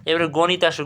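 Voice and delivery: ordinary delivery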